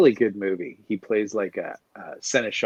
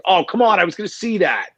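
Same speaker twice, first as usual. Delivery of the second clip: loud, high-pitched spoiler complaint; mock protest; 9 semitones higher, 6 dB louder than usual. Moviegoer objecting to a Sixth Sense spoiler, mock protest. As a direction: whine it as an outraged imitation, pitched up.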